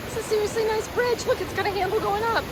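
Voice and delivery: high gleeful gush; excited. Real, not acted. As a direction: squeal over the bridge. Delighted by the bridge.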